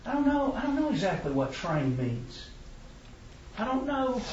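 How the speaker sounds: mock-confused mumbling; foggy confusion. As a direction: play dumb about what train means. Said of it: "very intelligent people start to go foggy".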